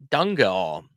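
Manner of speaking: "Danke all" said as DUNG-uh-aw, as one word; "Danke all" drawn into an open aw ending; regional pronunciation. Shown as a demonstration. Teacher shows a regional thank you, "Danke all" as DUNG-uh-aw. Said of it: "hear some people say".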